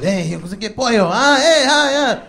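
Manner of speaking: mock-smooth sweet talk, rising high; hammed-up buddy-buddy charm in Spanglish; smooth schmoozing. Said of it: "works his magic all smooth".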